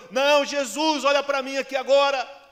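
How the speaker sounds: very high, full-volume desperate yell; defiant shout. Acted. Blind man overriding the crowd to reach Jesus, defiant shout. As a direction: refuse to be silenced, yell for attention. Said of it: "ele ouviu o grito de Bartimeu".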